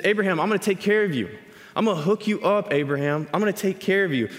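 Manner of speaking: mock buddy-God, high and quick; God as an excited friend. God hyping up Abraham like an excited friend. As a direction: pitch it up and rush it, promising. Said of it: "He says".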